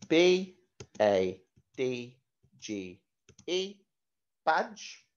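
slow, letter-by-letter spelling. Shown as a demonstration. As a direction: spell each letter clearly for the class.

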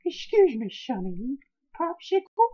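very high-pitched comic character; put-on character voice. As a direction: squeeze out a silly, high little voice. Character voice in laugh challenge.

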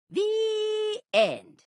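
high held sung "The end". Acted. Storyteller sings the closing "The end". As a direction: sing "The end" out on one high note.

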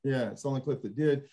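ordinary talk

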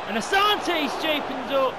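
sudden high, loud yell; urgent shout. Real, not acted. Gamer yelling as Asante breaks away, urgent shout. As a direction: burst out shouting at the play.

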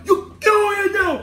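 high mock order; imitated patrol kid. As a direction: call out in an overexcited kid voice. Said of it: "And he's like".

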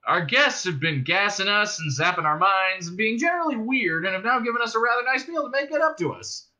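high, lively character voice; easygoing Zaphod, explaining. Acted. Zaphod fills Arthur in over a meal, explaining breezily.

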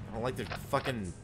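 ordinary talk, nothing marked

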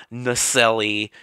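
Nacelli with an added ee ending; mispronounced name. Shown as a demonstration. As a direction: say "Nacelli" as nuh-SELL-ee. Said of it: "Stop saying his name wrong".

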